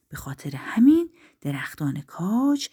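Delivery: ordinary delivery, nothing marked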